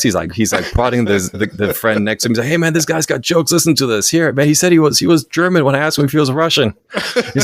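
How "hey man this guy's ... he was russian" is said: high-pitched imitation of a tickled frat brother; raised, animated retelling voice; amused excitement. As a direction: excitedly pass the joke on to a buddy. Retelling brother showing off joke to friend.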